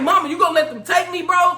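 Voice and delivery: high, fast yell to mama; retold yelling. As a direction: re-enact a rapid, high-pitched protest. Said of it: "like, I'm yelling this".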